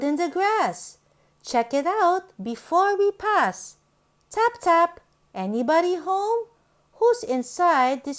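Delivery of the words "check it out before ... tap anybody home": ordinary delivery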